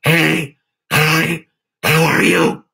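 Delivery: loud throaty demon greeting. Shown as a demonstration. Showing the chat a weird throat trick, demon voice. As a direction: force it through the throat like a demon. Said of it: "scaring everybody with a demon voice".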